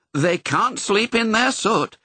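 high, slow, insistent statement; firm. A narrator's reading. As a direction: lay down the rule firmly. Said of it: "said Nanny Cook firmly".

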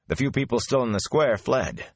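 ordinary delivery

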